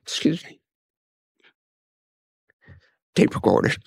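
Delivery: real tearful catch, voice failing; fighting back tears to get words out; choked up, crying. Real, not acted. Apologizing to the interviewer mid-story about his late father, choked up. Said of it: "you don't cry with anyone else".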